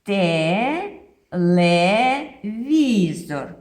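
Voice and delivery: "телевізор" drawn out very slowly and loud; stretched teaching word. Shown as a demonstration. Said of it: "Скажемо". (дуже повільно і голосно «телевізор»)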